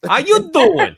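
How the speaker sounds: loud, high New Jersey greeting; mock New Jersey accent. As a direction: belt out a Jersey hello.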